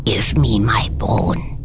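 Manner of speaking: slow, whispered little voice from the cupboard; teeny tiny voice. A narrator's reading. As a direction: whisper it eerily, drawing it out. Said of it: "by a teeny tiny voice from her teeny tiny cupboard".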